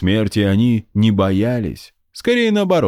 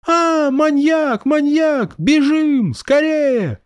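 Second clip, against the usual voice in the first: high kid playing at fright; mock-scared child; much slower than usual. A narrator's reading. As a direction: squeal like a kid in a game. Kids flee shouting "maniac.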